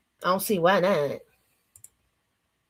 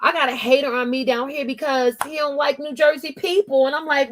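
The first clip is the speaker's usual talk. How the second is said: ordinary talk, nothing marked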